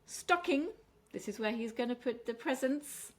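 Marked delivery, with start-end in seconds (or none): 0.0-0.7 "Stocking" with a high, strong first syllable; first-syllable stress. Shown as a demonstration. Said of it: "stress on the first syllable".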